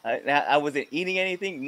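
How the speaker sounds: ordinary talk, nothing marked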